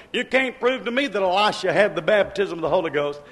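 ordinary talk

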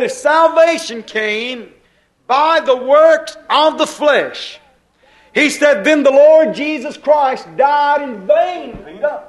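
Paul's slow rebuke of Peter; preached rebuke. Acted. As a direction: thunder it at Peter.

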